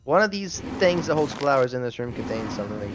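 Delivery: usual speaking voice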